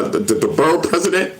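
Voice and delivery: usual speaking voice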